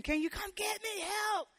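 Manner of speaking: high, hammed-up cry for help; mock call for help. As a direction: play the drowning Peter begging the boat. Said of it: "He didn't ask them".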